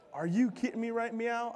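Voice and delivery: hammed-up outrage at the audience; mock shock. Mock shocked reaction after gasp.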